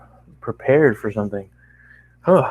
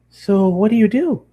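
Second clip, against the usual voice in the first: pony voice; 11 semitones higher, 4 dB louder than usual. Acted. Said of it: "she reiterated her question".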